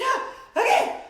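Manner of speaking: startled realization. Retold or imitated. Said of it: "I said".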